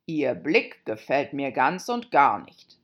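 a displeased king grumbles; grumpy king voice. A narrator's reading. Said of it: "sagte der König".

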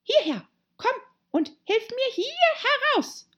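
high, urgent animal voice; irate command. A narrator's reading. Rabbit calling Pat to help him out, high and irate. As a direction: order help in a high, cross voice.

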